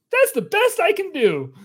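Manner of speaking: high, loud glitchy helper; bright robotic apology; chirpy assistant. Acted. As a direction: apologize like a broken gadget. Genie apologizes after shock.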